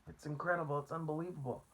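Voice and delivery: high, astonished cry; can hardly believe it; amazed. A narrator's reading. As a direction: travelers astonished, high voiced. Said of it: "they cried".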